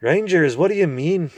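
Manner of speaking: high, loud cranky dwarf; deaf old dwarf. Acted. Deaf dwarf mishearing the owl, loud and cranky. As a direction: mishear it and bark back.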